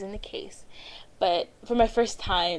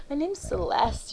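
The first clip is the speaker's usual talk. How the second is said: higher, slow put-on persona; playing a character; 8 semitones higher, slower than usual. Introducing herself to the counter staff, in character. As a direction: sell the fake name sweetly. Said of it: "just being this character".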